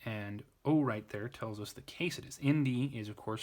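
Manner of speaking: ordinary talk, nothing marked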